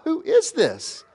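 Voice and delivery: very high, slow cry of wonder; astonished disciples. Retold or imitated. Disciples seeing winds and waves obey, astonished. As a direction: ask it high and drawn out, astonished.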